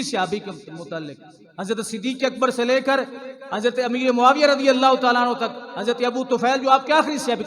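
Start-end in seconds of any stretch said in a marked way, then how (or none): 1.6-7.4 loud, high, mounting sermon voice; forceful preaching. Real, not acted. Preacher defends every companion against abusers, forceful preaching. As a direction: thunder a warning at the congregation.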